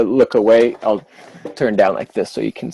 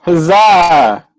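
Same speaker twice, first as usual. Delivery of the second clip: triumphant cheer; 7 semitones higher, 5 dB louder than usual. Real, not acted.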